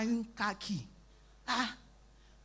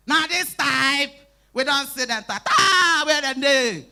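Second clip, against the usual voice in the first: loud slow angry shouting; hammed-up angry shout; retold angry shout; 4 semitones higher, 9 dB louder, slower than usual. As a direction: bellow at the crowd looking for them.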